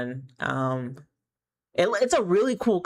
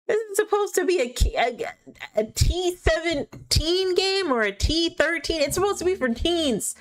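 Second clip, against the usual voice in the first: outraged disbelief; 11 semitones higher than usual. Real, not acted. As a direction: squeal it in scandalised disbelief. Outraged reaction to teen-rated costume.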